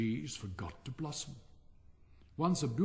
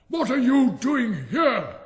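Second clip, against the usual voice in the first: big, slow, much higher and louder gruff shout; gruff giant voice; 11 semitones higher, 13 dB louder, slower than usual. A narrator's reading. Giant catching children in his garden, gruff shout. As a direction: bellow it down at the children like an angry giant. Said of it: "he cried in a very gruff voice".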